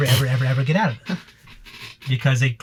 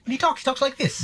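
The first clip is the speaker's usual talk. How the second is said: much higher, softer attempt at a British accent; Henry Cavill British accent; 12 semitones higher, 5 dB quieter than usual. Podcaster shows how the British actor naturally talks, imitated high British accent. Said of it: "He said way better in an actual real accent, not me".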